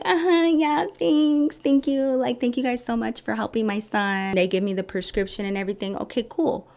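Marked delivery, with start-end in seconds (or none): 0.0-4.4 much higher, sugary fake thanks; forced politeness. Mother thanking the clinic staff with forced politeness. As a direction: sweeten it while you seethe.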